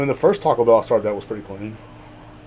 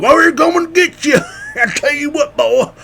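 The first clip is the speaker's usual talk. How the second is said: much higher, louder mocking threat; mock Facebook threat; 14 semitones higher, 4 dB louder than usual. Mocking hometown men threatening on Facebook. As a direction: sneer it in a high mocking voice. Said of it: "if you say one thing on Facebook".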